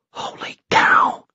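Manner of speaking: slow, awed whisper; hushed stunned whisper. A narrator's reading. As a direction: breathe it in amazement. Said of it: "whispered Tom".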